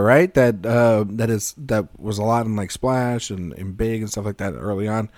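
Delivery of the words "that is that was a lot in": ordinary delivery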